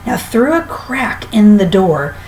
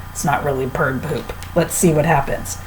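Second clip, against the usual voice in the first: quiet aside; 5 semitones lower, 6 dB quieter than usual. A narrator's reading. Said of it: "aside to listeners".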